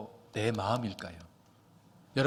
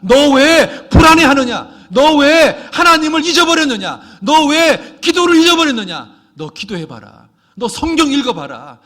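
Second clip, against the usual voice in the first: high, loud scolding of his own soul; David shouting down his own despair; self-rebuke shout; 13 semitones higher, 19 dB louder than usual. Acted. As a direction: bellow at yourself to wake up. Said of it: "다윗이 자기한테 하는 겁니다".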